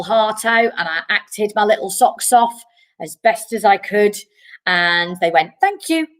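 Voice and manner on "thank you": high, sing-song polite thank you; polite panel brush-off. Retold or imitated. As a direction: mimic the casting panel's chirpy dismissal. Casting panel dismisses her again.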